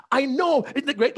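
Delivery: very high, gushing excitement; high giddy cheer; excited. Retold or imitated. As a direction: bubble over again. Excited husband interrupts again.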